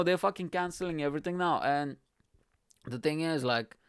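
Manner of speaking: normal talking voice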